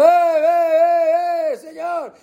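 high, drawn-out calls of eh, eh, señor; high wheedling shout at the Lamb; mock demanding shout. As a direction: pester the lamb like a beggar who wants everything. Mocking how people shout at lamb.

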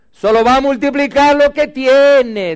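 high, loud preacher's proclamation; preaching loudly. Real, not acted. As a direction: proclaim it over the church. Pastor preaching to congregation.

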